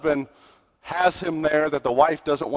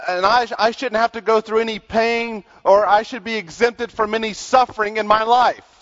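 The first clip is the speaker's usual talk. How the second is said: higher-pitched, emphatic sermon delivery; preaching; 8 semitones higher, 4 dB louder than usual. Acted. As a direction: press the congregation with pointed questions. Preaches to congregation.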